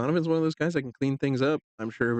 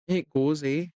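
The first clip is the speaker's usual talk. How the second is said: put-on light Canadian accent on "It goes, eh?"; 3 semitones higher, slower than usual. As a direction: tease with a Canadian "eh?". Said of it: "You Canadian accent me today".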